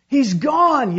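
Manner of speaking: high, very loud urgent shout; yelled. A narrator's reading. Uncle tells the swimmer to give up, yelling. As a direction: shout bad news across the rapids. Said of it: "yelled Uncle Reuben".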